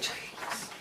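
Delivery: breathy whisper of a name, very soft. Retold or imitated. Driver imagining hissing the name at a slow car. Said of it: "maybe I was gonna be like".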